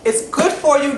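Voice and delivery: normal talking voice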